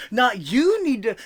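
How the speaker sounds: drawn-out Jerry Springer guest sass; loud, slow sassy scolding; sassy talk-show woman. Retold or imitated. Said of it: "chicks fight with their man".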